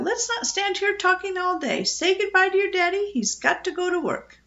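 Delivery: high-pitched brisk bird; insistent, high parrot voice; bossy parrot. A narrator's reading. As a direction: order the child along in a high voice. Parrot bosses the girl along.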